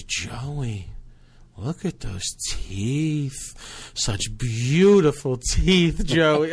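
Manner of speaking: very slow, stretched-out awe in imitation; enamored grandma. Grandma admiring the girlfriend's teeth, slow enamored imitation. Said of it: "she was enamored with Kerry's teeth".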